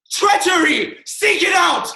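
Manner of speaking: loud, high cry of outrage; furious shout. Acted. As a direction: roar it at the whole court. Hamlet raging at the treachery.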